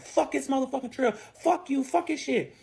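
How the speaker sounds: high-pitched retold tantrum; imitating pissed boyfriend. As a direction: act out him cursing in a temper. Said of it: "He's pissed".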